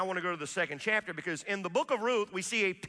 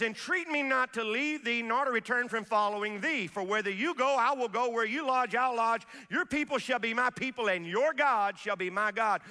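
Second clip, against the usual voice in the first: scripture recited with feeling; 4 semitones higher than usual. Acted.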